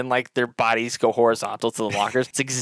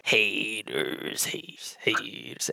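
whispered sing-song chant; whispering; 7 dB quieter, much slower than usual. Acted. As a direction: whisper it under your breath.

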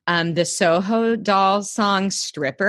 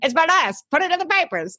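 1940s newsroom voice; 8 semitones higher, much faster than usual. Pretend. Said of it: "a place of nineteen forties newsroom".

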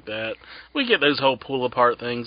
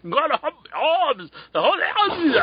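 mock Irish accent; 14 semitones higher than usual. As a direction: ham up Becky's brogue. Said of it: "can't get that accent".

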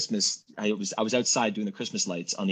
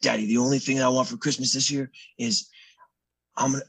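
lightly voiced raspy child, earnestly pleading; raspy little son; 4 semitones higher than usual. Dad retelling son's wish for Santa, lightly raspy. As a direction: imitate your son making his one wish. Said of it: "he has this raspy voice".

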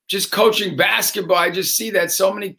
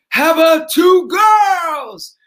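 calling out; 9 semitones higher, 6 dB louder, much slower than usual. Retold or imitated. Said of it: "And I'm like".

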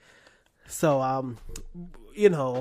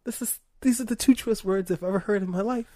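mock crying; 7 semitones higher than usual. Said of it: "I'm crying because".

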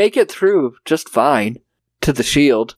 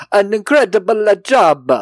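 raised, hammy fake Italian accent; terrible Italian accent; 6 semitones higher than usual. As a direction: ham up a bad Italian accent. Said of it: "That's a terrible Italian accent".

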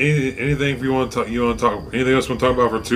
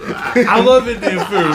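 laughing; 8 semitones higher, slower than usual. Pretend. Repeating joke with laughter.

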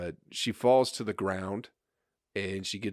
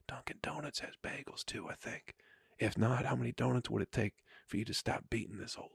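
soft unvoiced whisper into his ear; 10 dB quieter than usual. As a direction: murmur it gently like calming a child. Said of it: "probably whispering in his ear".

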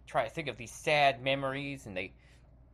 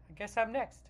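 ordinary talk

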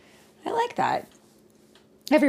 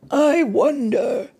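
put-on higher, slow, pondering old Noah; Father Noah; 9 semitones higher, 6 dB louder, slower than usual. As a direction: muse aloud as a worried Father Noah. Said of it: "he said".

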